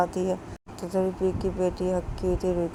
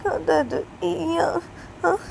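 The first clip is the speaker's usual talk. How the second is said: tearful concern; 10 semitones higher than usual. Acted. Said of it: "बोलती".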